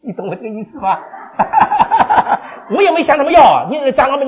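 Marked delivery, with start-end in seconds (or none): 1.0-2.7 a long high unvoiced giggle; high breathy laugh. Real, not acted. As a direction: giggle high at your own joke. Laughs at his own joke. (高音气声笑)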